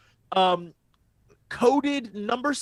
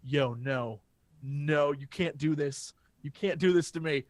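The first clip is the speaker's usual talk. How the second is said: mock despair; 8 semitones lower, 8 dB quieter than usual. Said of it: "I'll be like".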